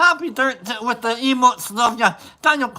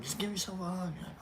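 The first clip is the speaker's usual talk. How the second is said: hushed, voiceless aside; whisper; 3 semitones lower, 19 dB quieter, much faster than usual. Acted. Streamer telling viewers to hug themselves, whispered. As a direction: whisper it very softly, like a private moment.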